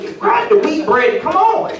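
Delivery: high, sharp dad scolding; exasperated dad. Retold or imitated. Dad scolding daughter dancing at the bread, high. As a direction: snap at the kid to move along.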